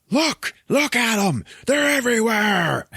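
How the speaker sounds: high, drawn-out frantic yelling; hammed-up alarm. [name] showing Keith the aliens in the street, mock frantic yell.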